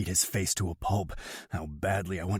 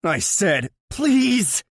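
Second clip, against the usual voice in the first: much higher, louder desperate plea; begging; 13 semitones higher, 9 dB louder, much slower than usual. Acted. Desperate brother begs to be trusted, loud plea. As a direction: beg with everything. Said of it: "Yuri begs".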